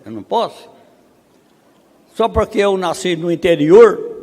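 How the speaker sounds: usual speaking voice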